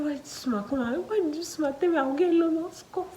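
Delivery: high, tearful wail; tearful high-pitched lament; crying. Retold or imitated. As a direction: retell the sob with a breaking high voice. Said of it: "I was crying".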